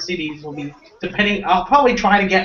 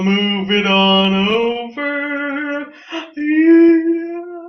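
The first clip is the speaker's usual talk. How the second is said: silly drawn-out voice; 9 semitones higher, much slower than usual. Pretend. Playful drawn-out voice.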